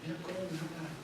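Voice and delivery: mock prayer. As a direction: pray for revenge half-joking. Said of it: "empieza a pedir". (oración burlona en voz baja)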